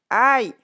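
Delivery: sudden cry at a bump on the head; acted pain yelp. A narrator's reading. Chestnut hits Automne, loud yelp of pain.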